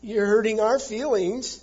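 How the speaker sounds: high, loud whiny complaint; mock whining. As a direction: whine it like a wounded crybaby, hammed-up. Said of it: "kind of a whining".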